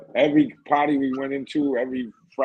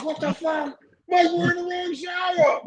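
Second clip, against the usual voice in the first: shocked yell. Retold or imitated. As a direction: yell it like you just caught the mistake. Shock at co-ed shower.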